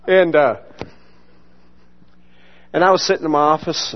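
ordinary delivery

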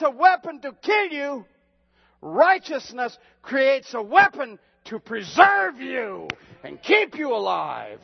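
high, loud, slowed sermon cadence with shouted peaks; preaching at full force. Acted. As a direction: thunder the scripture over the congregation.

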